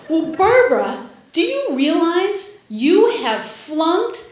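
slow, pointed scolding; exasperated mother. Retold or imitated. Mother scolding her daughter, slow and exasperated. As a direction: spell out the disgrace slowly.

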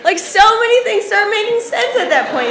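usual speaking voice

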